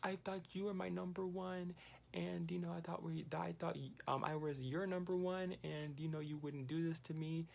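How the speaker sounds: a hint of a tearful plea; imitating bawling. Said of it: "she's just bawling her eyes out".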